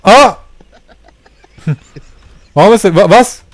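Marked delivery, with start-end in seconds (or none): 0.0-1.6 startled scream. Real, not acted. Sudden crash in the game.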